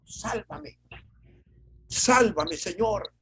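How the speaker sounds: Peter's pleading cry for rescue, hammed up; desperate cry. Pedro hundiéndose clama a Jesús, grito desesperado. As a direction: cry out like a man who is sinking. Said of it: "el grito desesperado".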